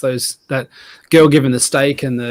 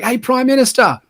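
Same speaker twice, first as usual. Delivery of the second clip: hammed-up shout across a pond; imitated calling out; 11 semitones higher than usual. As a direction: call out to someone far away. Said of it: "just shouts across the, across the pond".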